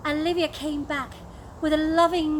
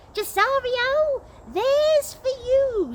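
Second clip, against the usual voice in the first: much higher, slow squeal; 11 semitones higher, 3 dB louder, slower than usual. Acted. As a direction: squeal it while swinging a fist. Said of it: "squealed Sir Andrew".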